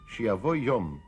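ordinary talk, nothing marked

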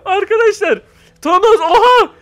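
high, loud excited yelps; excited shouting. Real, not acted. Gamer yelling to viewers as Thanos shows up, excited. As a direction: blurt it out in shock at the screen. Sees Thanos appear in game.

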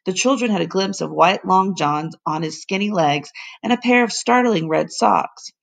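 usual speaking voice

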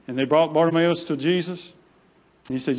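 ordinary talk

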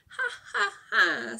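loud high taunting ha ha ha; gleeful laugh. Acted. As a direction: laugh right at the fox. Rabbit laughs at tricked fox.

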